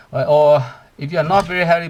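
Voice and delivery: normal talking voice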